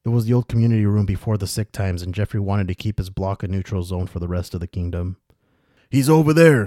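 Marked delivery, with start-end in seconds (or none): 5.9-6.7 high, loud call to the other guards; raised guard's yell; yelling from afar. A narrator's reading. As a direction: call out the sighting across a distance. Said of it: "one of the guards yell from the distance".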